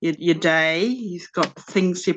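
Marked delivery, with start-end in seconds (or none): none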